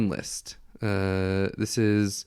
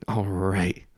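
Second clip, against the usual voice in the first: whisper; 2 semitones lower than usual. Real, not acted. Awkward whisper after no response.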